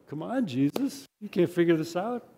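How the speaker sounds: gently scoff, as if it's obvious; playful acted exasperation; mock incredulous. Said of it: "It's like".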